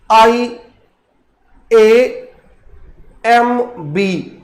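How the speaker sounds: slow letter-by-letter spelling of I A M B. Shown as a demonstration. Teacher spelling iamb for students, slow letter by letter. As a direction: spell the word out one letter at a time, slowly. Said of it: "उसको आयम्ब कहा जाता है".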